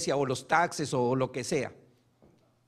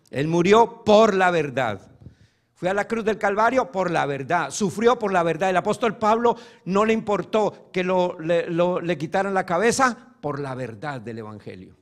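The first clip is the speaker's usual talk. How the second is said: full-force preaching; 5 dB louder than usual. Real, not acted. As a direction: drive it home to the congregation.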